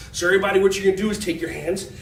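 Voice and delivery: ordinary talk, nothing marked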